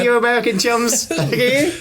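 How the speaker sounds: high, put-on voice teasing American chums; mock posh voice. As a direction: tease them in a lofty put-on voice.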